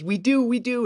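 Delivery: high-pitched eager insistence; retold earnest reassurance, pitch jumping up.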